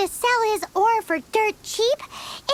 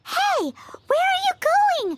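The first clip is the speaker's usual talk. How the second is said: high call after someone walking away; hey, catch them before they leave; sharp higher call across the room; 6 semitones higher than usual. Acted. Calling after someone leaving.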